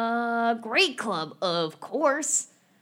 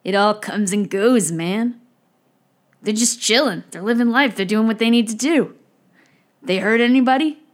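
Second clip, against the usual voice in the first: louder easygoing beach bum; laid-back surfer voice; 7 dB louder than usual. Acted. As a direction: chill surfer philosophizing. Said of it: "But what would David do?".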